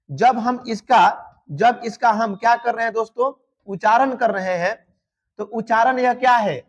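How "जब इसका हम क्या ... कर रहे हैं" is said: ordinary talk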